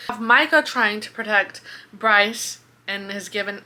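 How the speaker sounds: normal talking voice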